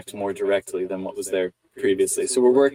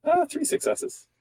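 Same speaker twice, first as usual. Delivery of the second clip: much higher, slow, delighted announcement; excited; slower than usual. Real, not acted. Player announcing the dice result, delighted. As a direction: savour the good roll. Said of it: "dice result".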